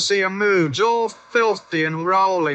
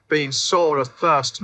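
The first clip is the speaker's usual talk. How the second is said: ordinary delivery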